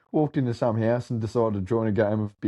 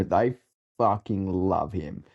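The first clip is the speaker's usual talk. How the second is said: ordinary talk, nothing marked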